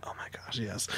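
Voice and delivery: hushed, breathy whisper; gleeful aside under the breath; whispered delight. Retold or imitated. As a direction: whisper it as a secret little victory.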